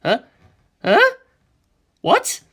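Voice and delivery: high, loud startled yelps; shocked huh. Acted. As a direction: blurt it out in disbelief. Shocked by Kaz's claim.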